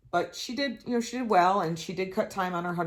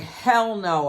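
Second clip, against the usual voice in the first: loud outburst; 4 semitones higher, 6 dB louder than usual. Retold or imitated. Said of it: "I'm like".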